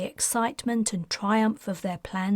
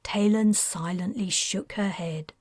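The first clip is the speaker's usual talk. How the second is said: usual speaking voice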